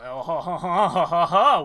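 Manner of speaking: loud booming ho ho laugh; hearty laugh. Real, not acted. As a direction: burst out laughing. Big laugh at the reveal.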